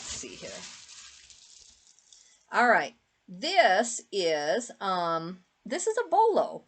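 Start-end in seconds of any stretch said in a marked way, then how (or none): 5.6-6.6 excited surprise. Real, not acted. Surprised find of a bolo.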